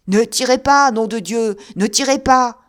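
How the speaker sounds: loud, panicked begging cry; frightened plea not to be shot; terrified shout. Acted. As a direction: yell as if a gun is on you. Terrified plea at imagined gun.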